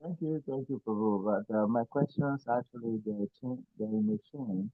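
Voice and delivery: far from the mic, very faint; barely audible. Real, not acted. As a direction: speaks too far from the mic. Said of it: "Your voice was very low".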